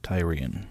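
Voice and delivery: Tyrion softly, begun like "tie"; TIE-ree-un. Shown as a demonstration. Host sides with his old reading, Tyrion said TIE-ree-un. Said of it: "always in the Tyrion camp".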